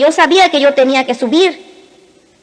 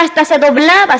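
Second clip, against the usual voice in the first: ordinary delivery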